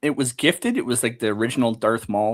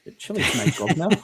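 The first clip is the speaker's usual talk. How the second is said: playful Cockney grave robber; lightly done Cockney accent; 5 semitones higher than usual. Pretend. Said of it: "heavy Cockney accent".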